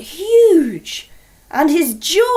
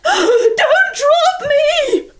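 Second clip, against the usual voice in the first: high, loud, very slow cry of fright; terrified cry; 14 semitones higher, 6 dB louder, much slower than usual. Acted. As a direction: wail it in terror. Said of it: "And he went".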